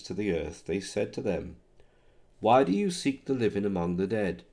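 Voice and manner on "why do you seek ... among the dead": usual speaking voice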